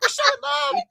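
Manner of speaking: hammed-up high girly wail; mock Anna voice. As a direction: squeal it like a smitten princess. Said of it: "Oh, I love him".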